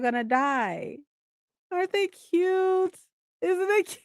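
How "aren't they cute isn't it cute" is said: cooing. Real, not acted. Gushing over flowers.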